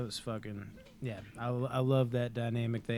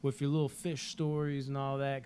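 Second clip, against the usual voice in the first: higher-pitched taunting imitation; mocking; 3 semitones higher than usual. Retelling Tommy making fun of him, mocking higher voice. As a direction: make fun of him as you send him off. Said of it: "He was making fun of him".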